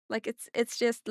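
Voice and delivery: normal talking voice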